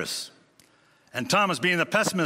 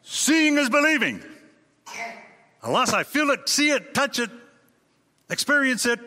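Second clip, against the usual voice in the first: much higher, louder proclaiming; loud declaration; 10 semitones higher, 3 dB louder than usual. Retold or imitated. Preacher voicing Thomas's declaration to the disciples, high and loud. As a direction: proclaim it like a challenge. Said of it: "Thomas declaring".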